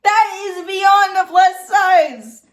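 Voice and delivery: high, loud, drawn-out exclamation; exasperated, stretching out the words; incredulous outburst. Real, not acted. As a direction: wail it slowly in disbelief. Outraged reaction to plus size claim.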